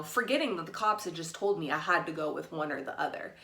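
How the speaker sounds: usual speaking voice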